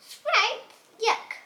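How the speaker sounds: high, loud cry of disgust; wolf revolted by the soup, high loud yuck. Acted. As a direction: spit out the sprouts in disgust.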